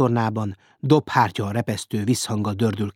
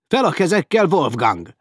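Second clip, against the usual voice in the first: loud, high-pitched shouted order; raised voice, ordering hands up; shouted command; 7 semitones higher, 6 dB louder than usual. A narrator's reading. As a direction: call out a sharp arrest order. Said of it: "kiáltott Sedlinz".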